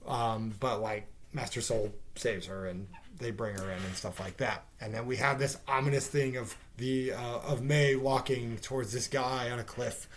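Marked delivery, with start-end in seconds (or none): none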